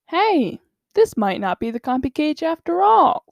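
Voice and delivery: high-pitched, alarmed realization; sudden realization. Retold or imitated. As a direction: jump the pitch up as it dawns on you. Darius suddenly realizes the mistake.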